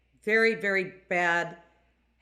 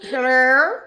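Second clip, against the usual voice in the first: loud, higher silly hello; funny voice; 4 semitones higher, 9 dB louder than usual. Shown as a demonstration. Sister showing the family catchphrase in a funny loud voice. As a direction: belt out the family's catchphrase in a put-on voice. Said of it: "we talk in a funny voice".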